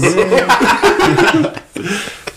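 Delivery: high-pitched laughing. Real, not acted. Laughs after his own quote.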